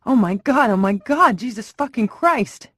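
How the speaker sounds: high, loud horrified cry; horrified yelling. Acted. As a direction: yell in shock as the shots hit. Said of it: "yelling at the top of his lungs".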